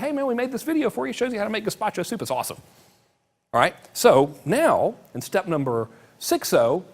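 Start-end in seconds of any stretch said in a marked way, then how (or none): 0.0-2.6 casual and fast, pitched higher, easygoing; laid-back cool buddy. Retold or imitated. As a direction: toss it off like a relaxed friend. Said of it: "We're being cool".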